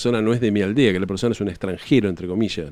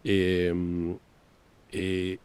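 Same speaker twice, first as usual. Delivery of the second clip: normal talking voice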